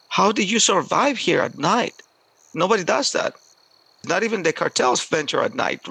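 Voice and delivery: high, swooping incredulous voice; retold amazement. Farmer learns the boy survived the mountain night, amazed. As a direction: imitate a man who can't believe it, at normal volume.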